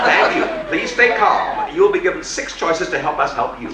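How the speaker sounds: cheerful robot listing emergencies; automated voice. Retold or imitated. Emergency room recording options.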